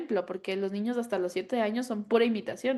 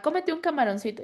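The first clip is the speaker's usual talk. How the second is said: coaxing grandfather; 6 semitones higher than usual. Retold or imitated. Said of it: "Y mi papá".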